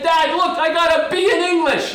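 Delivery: put-on high, eager child showing off; proud kid pleading with dad. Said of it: "I said".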